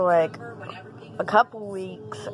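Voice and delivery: ordinary delivery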